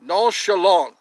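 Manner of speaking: loud mock-French flourish; French-accented exclamation; French pronunciation. Shown as a demonstration. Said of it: "Is that how they say it in French?".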